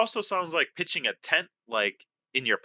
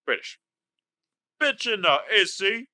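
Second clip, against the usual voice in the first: higher-pitched amateur Cockney; British accent; 5 semitones higher than usual. Acted. Player answers the quiz in a mock British accent. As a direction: clip on a Cockney with dropped H. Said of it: "the best British accent".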